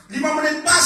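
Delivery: loud, high announcement of time; re-enacted shout as the clock runs out; acted time call. Acts out timing the anointing.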